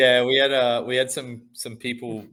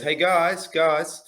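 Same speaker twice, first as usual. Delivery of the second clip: lightly voiced child, pitched higher; imitated kid calling out; 7 semitones higher, much slower than usual. Dad retelling his son hailing the carpet layers, lightly imitated calling out. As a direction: mimic a small boy getting the men's attention.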